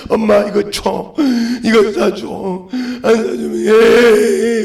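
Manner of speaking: put-on slow, drawn-out childish whine; whining child about to cry. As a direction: imitate a kid wailing for a toy. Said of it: "울어".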